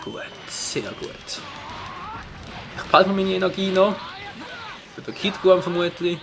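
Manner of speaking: ordinary talk, nothing marked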